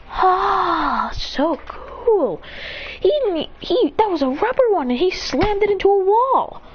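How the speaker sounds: put-on high, eager boy's voice full of wonder; excited kid Boruto. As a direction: gush like an amazed little kid. Boruto marvels at the kunai trick.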